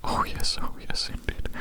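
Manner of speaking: usual speaking voice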